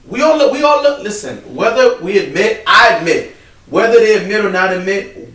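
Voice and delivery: can't hold back how impressed he is; excited praise. Real, not acted. Praising his brother's church, excited and loud.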